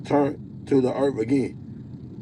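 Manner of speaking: ordinary delivery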